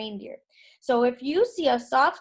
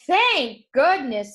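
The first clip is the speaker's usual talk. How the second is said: slow, louder relief; relieved jolly; 3 semitones higher, 6 dB louder, slower than usual. Acted. Santa relieved by the red glow, slow and jolly. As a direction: breathe out glad relief. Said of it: "suddenly jolly again".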